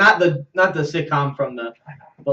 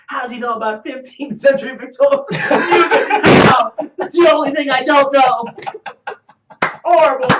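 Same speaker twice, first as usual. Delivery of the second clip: high, loud, hammed-up despair; stumped hero's mock anguish; 9 semitones higher, 4 dB louder than usual. The isolator stumped by Victoria question, high mock anguish. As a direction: wail it like a defeated comic-book hero.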